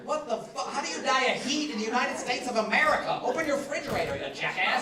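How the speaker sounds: mock rant. Comic ranting at people dying of heat, exasperated and loud. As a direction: scold them like idiots.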